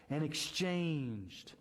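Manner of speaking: exchanged stretched very slowly; slow down hard on exchanged; stress on exchanged. Shown as a demonstration. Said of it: "I'm gonna emphasize that word when I read it".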